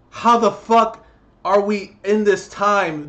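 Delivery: loud, furious shouting at abusive parents; angry rant. Real, not acted. Ranting at abusive parents on camera, loud furious shouting. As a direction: yell it at them, really furious. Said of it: "I'm getting too annoyed".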